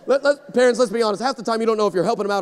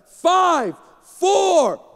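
high, louder final countdown; countdown call; 9 semitones higher, 5 dB louder than usual. Retold or imitated. Floor crew calling the final seconds to air, loud.

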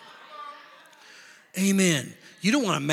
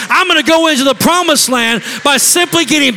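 preaching shout; 8 semitones higher, 12 dB louder than usual. Acted. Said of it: "start going".

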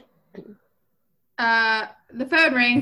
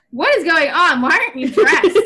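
raised, higher voice, playing the shocked dad demanding answers; outraged dad; 5 semitones higher, 6 dB louder than usual. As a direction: imitate a loud, outraged father. Dad walks in on undressed boy.

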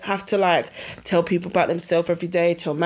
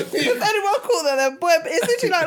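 high, fast, laughing through it; real laughter while retelling; 8 semitones higher than usual. Real, not acted. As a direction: giggle out the words. Laughing at the story.

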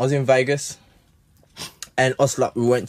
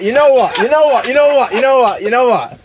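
loud, high, same falling tune each time; insistent interruption; 8 semitones higher, 8 dB louder than usual. Real, not acted. Host trying to get a word in, loudly insistent. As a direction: cut in repeatedly, pitched up and loud. Breaking into talk to get floor.

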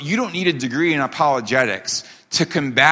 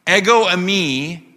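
higher, slow, drawn-out mock proclamation; mock unnatural declaration; 3 semitones higher than usual. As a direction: announce it absurdly, stretching each word. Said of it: "that's not how you talk".

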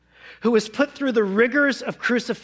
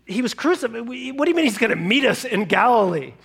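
bewildered disbelief; 2 semitones higher than usual. Acted. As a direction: stammer in stunned confusion. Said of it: "Now they're like".

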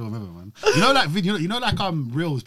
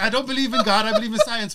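higher-pitched quote of the partner; imitating film sidekick; 9 semitones higher than usual. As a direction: play the sceptical little partner. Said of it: "He's like".

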